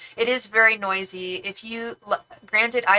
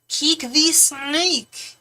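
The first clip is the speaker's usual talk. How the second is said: rapper name drawl; 8 semitones higher, 5 dB louder, much slower than usual. Retold or imitated. Naming a Bay Area rapper.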